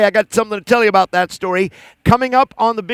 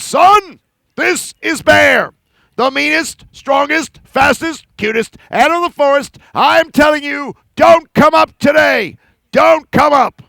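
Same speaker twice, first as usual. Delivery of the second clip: louder, higher, slow proclamation; commanding bear; 5 semitones higher, 3 dB louder than usual. Acted. Bear commanding the sun before all animals, loud and grand. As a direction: order the sun to stay down.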